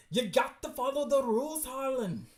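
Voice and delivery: high, loud put-on scold; scolding character. Character reprimanding Harlan over the rules, high and loud. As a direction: raise the voice high and lay down the law.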